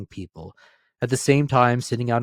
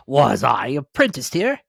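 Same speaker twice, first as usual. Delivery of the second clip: high, loud disbelief; can't believe his eyes; astonished; 5 semitones higher, 8 dB louder than usual. Acted. Scrooge recognizes the warehouse, astonished.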